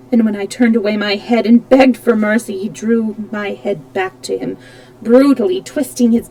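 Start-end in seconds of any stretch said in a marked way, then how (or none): none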